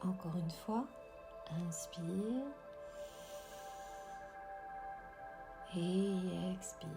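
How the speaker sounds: soft, slow whispered meditation voice; guided meditation. Acted. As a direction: guide the breathing gently, very slowly. Leads a breathing meditation.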